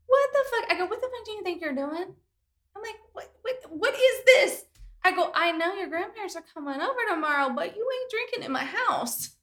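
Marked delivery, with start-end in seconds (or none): 0.1-0.7 high, loud mock outburst; retold outburst. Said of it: "I said". 0.9-9.4 higher mock scolding of the son; mock angry mom. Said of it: "you went full in".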